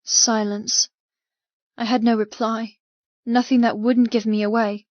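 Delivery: ordinary delivery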